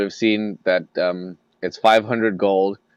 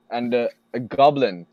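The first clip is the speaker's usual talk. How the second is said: ordinary talk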